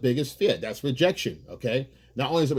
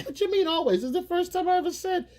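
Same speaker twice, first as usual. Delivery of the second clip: much higher, squeaky indignant retort; mock squeaky argument voice. Couple bickering over "always", much higher squeaky retort. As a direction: squeak it out like a cartoon spat. Acted quarrel, boyfriend's reply.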